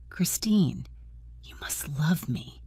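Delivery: breathless unvoiced whisper. A narrator's reading. Ghostly man whispering to Christine through the wall. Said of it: "the voice said".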